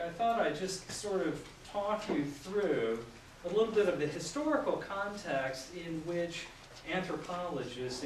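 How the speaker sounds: normal talking voice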